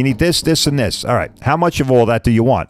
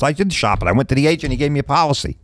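ordinary talk

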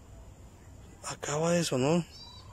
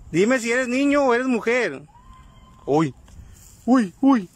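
higher, louder tense questions into the dark; urgent calls to a spirit; 8 semitones higher, 3 dB louder, slower than usual. Real, not acted. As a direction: demand an answer from the ghost.

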